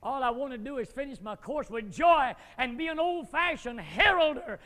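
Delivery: loud, fervent revival preaching; fiery preaching. Acted. Preacher urging the congregation toward revival, fiery preaching.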